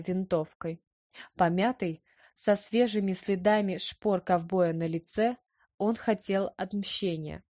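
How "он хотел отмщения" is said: usual speaking voice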